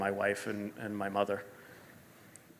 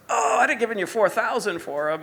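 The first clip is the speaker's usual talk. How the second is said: high, loud mock anguish; dismayed friend, imitated; 12 semitones higher, 7 dB louder than usual. Australian friend missed the sneakers, loudly dismayed. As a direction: wail it as the buyer who missed out.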